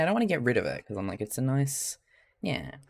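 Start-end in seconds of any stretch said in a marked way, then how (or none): none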